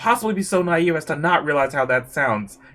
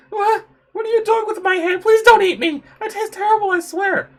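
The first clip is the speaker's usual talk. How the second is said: high, loud frightened babble; panicked pleading; 5 dB louder than usual. Acted. Human begging not to be eaten, high-pitched panic.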